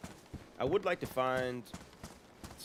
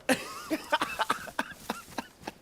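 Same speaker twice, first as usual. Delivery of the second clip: high wheezing laugh. Real, not acted. Laughing at own voice.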